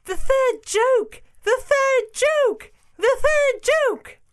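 very high, loud, drawn-out bird cry; excited jackdaw. Acted. Jackdaw crowing to the animals about the joke, in great excitement. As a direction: squawk it out slowly at the top of the voice. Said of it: "said the jackdaw in great excitement".

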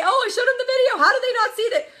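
high, rushed mock bafflement; mock frustrated recruiter. Recruiter baffled that prospects ignore video, mock exasperated.

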